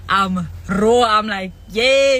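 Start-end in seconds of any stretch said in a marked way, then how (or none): 1.7-2.2 loud yay, half laughing; playful loud yay; loud mock cheer. Mock cheer, repeated bit.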